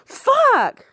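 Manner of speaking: very high, loud cursing scream; screaming curses after the driver; furious yell. Acted. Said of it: "and yelled".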